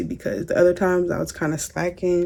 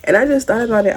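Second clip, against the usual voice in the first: ordinary delivery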